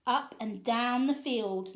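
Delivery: ordinary delivery, nothing marked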